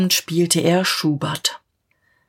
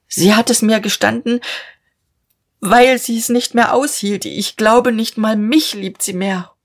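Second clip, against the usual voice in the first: higher, strained voice fighting tears; holding back sobs; 4 semitones higher than usual. A narrator's reading. Father pleading for his daughter, fighting back sobs. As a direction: plead while barely keeping from crying. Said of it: "das Schluchzen unterdrücken".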